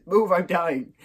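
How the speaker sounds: high, loud urgent quote; hammed-up panicked plea; mock desperation. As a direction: shove past as if about to burst. Acts out desperate dash to toilet.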